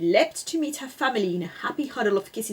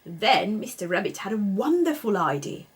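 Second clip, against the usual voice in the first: ordinary delivery, nothing marked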